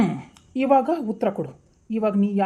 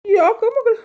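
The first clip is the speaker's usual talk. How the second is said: tearful mother; 11 semitones higher, 5 dB louder, slower than usual. Acted. Mother consoling crying daughter.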